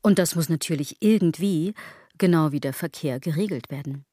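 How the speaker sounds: ordinary talk, nothing marked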